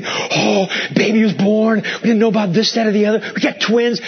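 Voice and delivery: high, eager and bubbly; excited proud parent. Retold or imitated. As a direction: gush about the new twins, voice lifted high. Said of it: "They're all excited".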